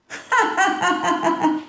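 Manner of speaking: high, rattling stage laugh; storyteller's triumphant cackle; acted laugh. A narrator's reading. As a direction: laugh in a long high-pitched run.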